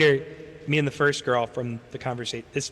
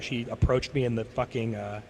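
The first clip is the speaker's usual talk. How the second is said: ordinary talk, nothing marked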